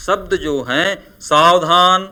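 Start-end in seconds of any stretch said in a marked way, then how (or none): 1.2-2.1 loud warning calls; loud cautionary shout. Shown as a demonstration. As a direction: call out a warning. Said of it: "चेतावनी के भाव".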